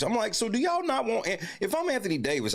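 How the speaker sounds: high, worked-up protest; heated rant. Real, not acted. Fan ranting about the Dallas crowd, high and heated. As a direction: get fired up about it.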